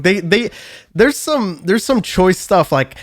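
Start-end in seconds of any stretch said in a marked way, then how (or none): none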